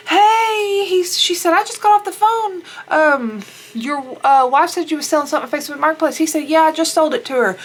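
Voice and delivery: high, loud voicing of the couple's exchange; retold dialogue. Retelling the sister-in-law and John's awkward exchange, high and loud. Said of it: "And he's like".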